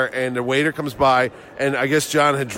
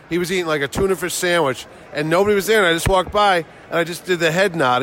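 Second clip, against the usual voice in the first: ordinary talk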